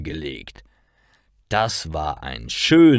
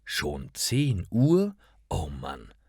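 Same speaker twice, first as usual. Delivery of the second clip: slow and soft, dropping into dismay; tired, weary; 2 semitones lower, 6 dB quieter, slower than usual. A narrator's reading. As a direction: drag it out sleepily, sinking at the end. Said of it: "Müde steht sie auf".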